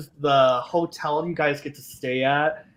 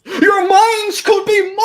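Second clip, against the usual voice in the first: very high, loud ghostly threat; mock menacing shout; 10 dB louder than usual. Ghost concierge teasing nervous guests, sudden menacing yell. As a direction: burst out as a ghost claiming their minds.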